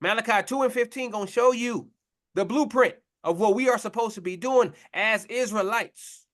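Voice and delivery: usual speaking voice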